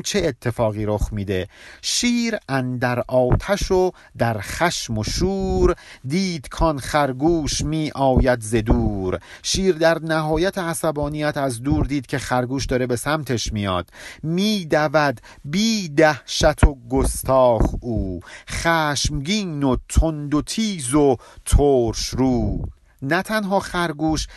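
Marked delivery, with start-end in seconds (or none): 1.8-9.2 slow, measured verse reading; poem recitation. Acted. 14.2-22.6 slow, measured verse reading; poem recitation. Acted.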